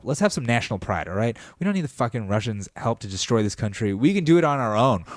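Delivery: usual speaking voice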